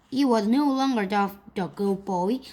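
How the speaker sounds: usual speaking voice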